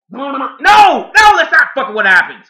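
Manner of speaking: loud outburst, pitch falling as it goes; exasperated shouting. Real, not acted. Reviewer rejecting the movie's logic, exasperated shout. Ranting, contradicts own sarcasm.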